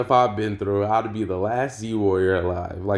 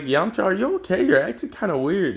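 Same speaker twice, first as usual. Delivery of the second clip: put-on raised, higher young Gohan voice; 10 semitones higher than usual. Gohan asking Yamcha if he is okay, higher. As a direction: lift the pitch for young Gohan.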